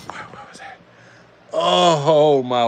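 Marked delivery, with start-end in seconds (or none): 0.1-0.8 breathless whisper to no one. Real, not acted. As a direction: whisper it, stunned and unsure what you saw. Surprised hush during the race.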